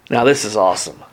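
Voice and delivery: ordinary talk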